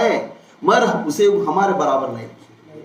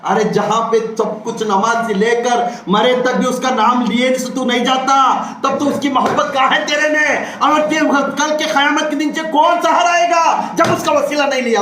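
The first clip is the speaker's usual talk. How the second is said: raised, forceful sermon voice rising in pitch; impassioned preaching; 6 semitones higher than usual. Real, not acted. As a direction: challenge the congregation, voice climbing.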